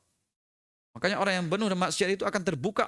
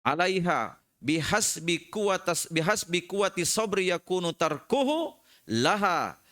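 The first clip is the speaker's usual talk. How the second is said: slow, measured reading of a classical Arabic text; chanting the quoted scripture slowly; slow Arabic recitation; 3 semitones lower, slower than usual. Acted. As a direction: recite it slowly, weighing each phrase.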